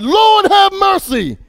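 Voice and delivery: a sinner crying out for mercy, slow and high. Acted. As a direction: cry it out slowly to heaven. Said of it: "and he cried".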